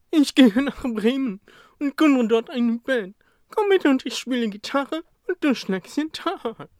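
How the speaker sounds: high, bright horse voice full of plans; eager horse. Acted. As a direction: pitch it up like a hopeful old mare. Horse proposes band.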